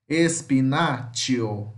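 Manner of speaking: espinaccio with a tch sound, said slowly; slow model word. Shown as a demonstration. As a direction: stretch espinaccio out, tch in the middle. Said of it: "fica com o som de X com T".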